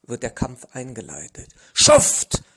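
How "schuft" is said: a loud strained groan of an insult. A narrator's reading. Pinned wrestler groaning an insult at his opponent. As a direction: push it out under a painful hold. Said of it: "stöhnt manchmal einer".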